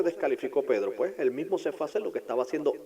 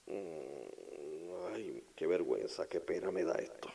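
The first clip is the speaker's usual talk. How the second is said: hushed embarrassed murmur; ashamed whisper; 7 semitones lower, 9 dB quieter, much slower than usual. Acted. Thomas ashamed of doubting, whispered. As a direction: breathe out the shame.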